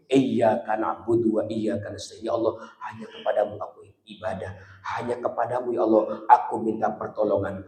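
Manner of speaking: soft, low whispered plea; quiet devoted prayer; humble prayer. Acted.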